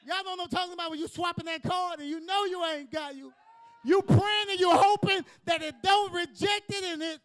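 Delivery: high, loud shouted preaching. Acted. Preacher shouting at the congregation about the declined card. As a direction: build the point into a loud preach.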